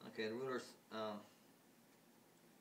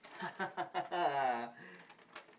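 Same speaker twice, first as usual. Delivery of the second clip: high, loud scornful chuckle; mocking laugh; 6 semitones higher, 5 dB louder than usual. Ruler laughs at the mother, high and mocking. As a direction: laugh at her.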